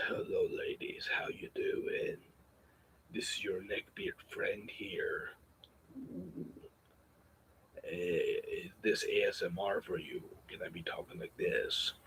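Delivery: slow creepy whisper to ladies; neckbeard ASMR whisper. Pretend. Neckbeard greeting the ladies as ASMR, in a slow whisper. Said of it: "We could talk like this".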